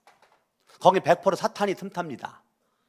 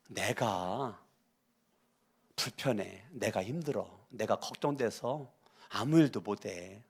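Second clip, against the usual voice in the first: gentle I-message; 6 semitones lower, 7 dB quieter than usual. Shown as a demonstration. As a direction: speak softly and honestly about your worry. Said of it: "아이 메시지는". (늦게 온 자녀에게 낮고 부드럽게 마음을 전하는 부모)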